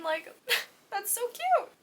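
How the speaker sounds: high laughing squeal over a cute moment; giggly squeal. Real, not acted. Reader gushing over a cute book scene, giggly squeal.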